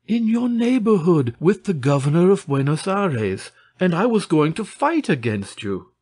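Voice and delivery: high-pitched, eager answer; excited reply. A narrator's reading. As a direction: blurt the news with excitement. Excited exchange of news.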